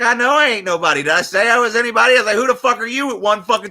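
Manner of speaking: high, loud, fast mock retort; retold heated comeback. Arguing with the lady in the store, heated fast comeback. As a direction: fire back at her fast.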